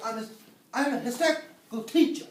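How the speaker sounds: usual speaking voice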